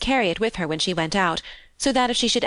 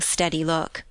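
ordinary delivery

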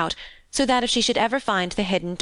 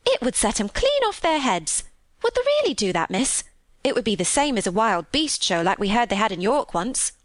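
higher, swooping Yorkshire maid, amazed; thrilled country housemaid; 3 semitones higher than usual. Acted. Maid imagining her siblings' amazement, thrilled. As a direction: marvel like an excited country maid. Voices delighted Martha.

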